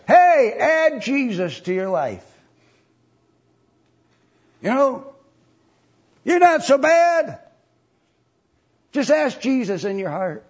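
high, loud, hammed-up salesman of easy religion; mock cheery pitch. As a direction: put on a high, breezy sales shout. Mocking trite salvation preachers.

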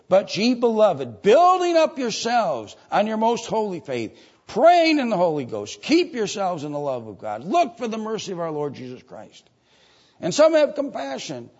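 high, loud pulpit proclamation; forceful scripture preaching. Real, not acted. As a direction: ring out the verse to the congregation.

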